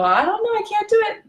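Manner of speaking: high, whining, helpless; whiny victim voice. Retold or imitated. Passive aggressive person giving up control, high and whiny. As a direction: whine that you just can't. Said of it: "what happens when you go".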